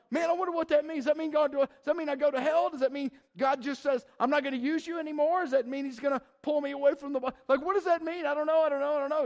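high, loud frantic worrying; anxious worried voice. Retold or imitated. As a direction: panicky rush of questions.

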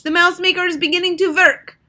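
high, full-volume gleeful shriek; exultant piercing witch voice. A narrator's reading. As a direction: screech your triumph to the whole hall. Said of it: "shrieked the Grand High Witch".

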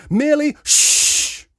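high, loud, slow word, then shush; pointed shush. A narrator's reading. Father defending himself to lout, pointed shush. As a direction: stretch it out and shush loudly.

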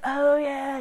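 mock dopey husband realising; oblivious husband. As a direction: play the husband who just noticed. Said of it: "he probably has no idea that you're like".

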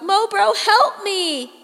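begging her brother for help; desperate cry. A narrator's reading. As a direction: shout for him to save you. Calling to little brother for help.

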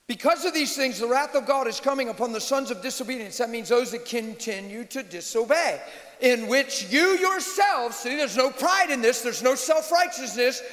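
high, emphatic pulpit delivery; forceful preaching. Real, not acted. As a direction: preach it hard, stretching the key phrases.

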